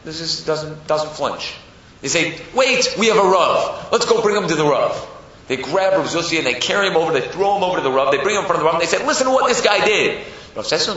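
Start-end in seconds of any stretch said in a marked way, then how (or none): 2.5-5.0 raised, louder crowd voice; urgent calling out. Acted. Townspeople deciding to bring him before the Rav, urgently. 9.0-10.2 high, loud complaint; indignant accusation. Acted. Crowd hauling him before the Rav, indignantly.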